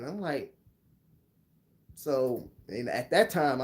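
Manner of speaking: ordinary talk, nothing marked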